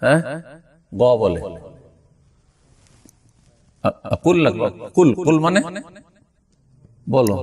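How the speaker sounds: normal talking voice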